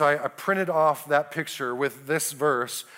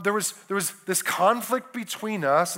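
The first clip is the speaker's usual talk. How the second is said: ordinary delivery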